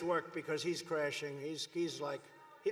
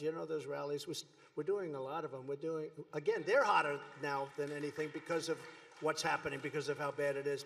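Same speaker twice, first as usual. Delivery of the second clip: ordinary talk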